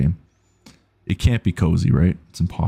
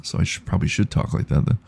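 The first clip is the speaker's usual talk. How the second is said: ordinary delivery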